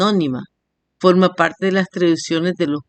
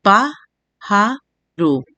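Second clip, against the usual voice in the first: "pájaro" very slowly as PÁ, JA, RO; split into syllables; much slower than usual. Shown as a demonstration. Teacher giving a three-syllable example, "pájaro" syllable by syllable. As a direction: say "pájaro" with gaps between syllables. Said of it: "tienen tres sílabas".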